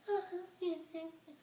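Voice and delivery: very high, barely there murmur; mimicking her mumble. Date mumbling thanks at the car door, tiny high murmur. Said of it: "Could barely hear her".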